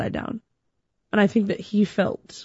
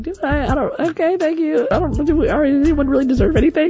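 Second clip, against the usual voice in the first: flustered modesty; 7 semitones higher, faster than usual. Retold or imitated. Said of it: "And I was like".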